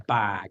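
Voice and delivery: "Bag" with the final consonant unreleased; cut-off ending. Shown as a demonstration. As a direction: let "Bag" cut out straight, without breath. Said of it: "It just cuts out".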